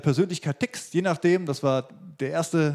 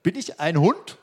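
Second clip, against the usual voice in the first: raised, louder indignant challenge; Goliath's taunting call; 4 semitones higher, 6 dB louder, slower than usual. Acted. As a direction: call it out as an affronted warrior. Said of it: "dann ruft dieser Goliath".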